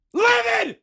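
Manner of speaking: high, loud screeched livid; over-the-top fake fury that goes too far; hammed-up shriek. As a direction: screech the word like a cartoon villain. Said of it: "That was too far".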